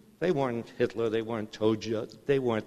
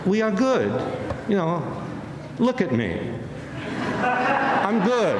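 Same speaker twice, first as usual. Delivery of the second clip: higher, louder mock self-praise; mock smug leader; 10 semitones higher, 3 dB louder, slower than usual. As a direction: parody a leader insisting he is good. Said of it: "That's what he said".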